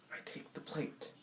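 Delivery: near-whispered narration; hushed suspense. A narrator's reading. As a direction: drop almost to a whisper for suspense. Suspenseful slow telling of handover.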